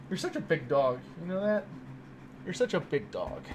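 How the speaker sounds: hushed affectionate doggy voice; doting pet talk. Real, not acted. Baby talk to dog.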